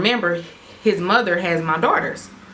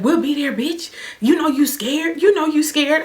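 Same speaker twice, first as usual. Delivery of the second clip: ex's taunt; 8 semitones higher than usual. Retold or imitated. Ex claims she is scared, high gloating taunt.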